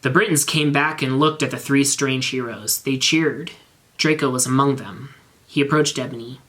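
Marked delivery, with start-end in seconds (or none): none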